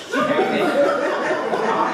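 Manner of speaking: high, loud laughing "come on"; cheering someone on while cracking up. Real, not acted. Coach laughing mid-exercise.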